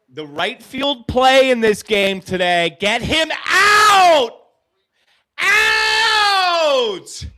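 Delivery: high, loud shouted call, stretched out; excited play call. Real, not acted. Fan calls the right field play live, shouting. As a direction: yell the out like the game depends on it.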